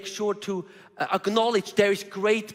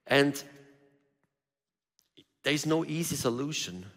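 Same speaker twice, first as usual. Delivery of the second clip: ordinary talk, nothing marked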